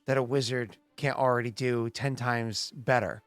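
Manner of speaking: usual speaking voice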